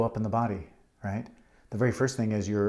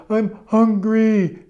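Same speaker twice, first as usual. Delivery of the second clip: put-on high, loud, slow drawn-out child wail; whining toddler; 11 semitones higher, 10 dB louder, much slower than usual. Two-year-old tells parent what they need, mock wailing.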